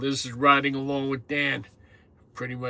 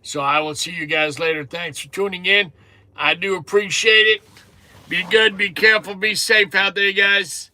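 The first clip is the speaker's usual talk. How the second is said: higher, louder cheerful goodbye; upbeat sign-off; 5 semitones higher, 8 dB louder than usual. Real, not acted. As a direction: send the viewers off warmly. Signing off to viewers.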